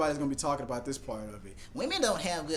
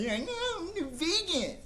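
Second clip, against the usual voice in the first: high whiny refusal; mock whiny wife; slower than usual. Wife refusing bacon, high whiny voice. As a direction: whine out the refusal. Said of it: "and say".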